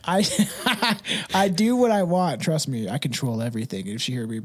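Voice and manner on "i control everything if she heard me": normal talking voice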